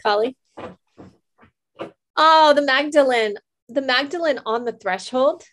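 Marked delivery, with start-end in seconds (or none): 2.2-5.4 higher, louder exclamation of delight; delighted surprise. Real, not acted. Host reveals the drawn card, delighted surprise. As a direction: greet the card with real excitement.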